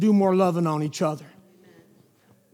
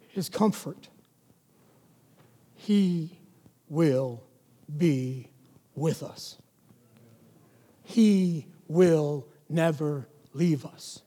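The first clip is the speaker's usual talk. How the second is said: very slow, quiet, each word set apart; slow and hushed; 6 semitones lower, 7 dB quieter, much slower than usual. Shown as a demonstration. Preacher slowing to a hush for his congregation, slow and soft. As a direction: let every word land slowly and softly. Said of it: "[name], why are you talking like this?".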